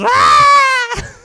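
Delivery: high, loud imitated shriek; mock the scream he gave. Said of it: "All he said was".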